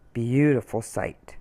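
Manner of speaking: usual speaking voice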